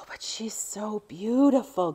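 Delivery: slow awed whisper; whispered admiration. Real, not acted. To her viewers, about a torn antique bag, a slow awed whisper. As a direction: breathe it out in wonder.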